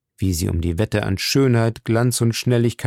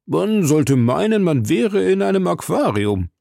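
exclaiming; 7 semitones higher than usual. Acted. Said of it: "rief Conseil".

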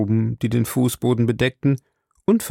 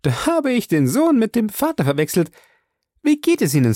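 high, flustered lady; chattering Mrs Baker; 11 semitones higher than usual. A narrator's reading. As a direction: babble on, mixing up father and son.

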